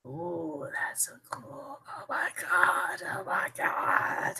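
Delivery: slow, hushed amazement; awed whisper. Real, not acted. Streamer marvels at the camera picture, whispering in awe. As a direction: whisper it in disbelief.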